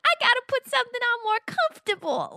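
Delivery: imitating a cartoon voice. Mocking a cartoon character's line, high squeaky put-on voice. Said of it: "Like that".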